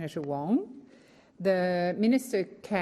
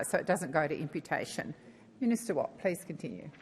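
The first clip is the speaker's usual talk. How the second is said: normal talking voice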